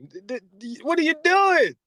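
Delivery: high, loud, sputtering stern father; a father threatening to embarrass his son, high and sputtering; Michael Irvin dad. Retold or imitated. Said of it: "when your daddy talking about".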